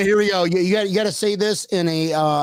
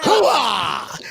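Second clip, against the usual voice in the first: Al Pacino; 4 semitones higher than usual. Retold or imitated. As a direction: bark it like a tough army colonel. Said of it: "it sounded more like Al Pacino".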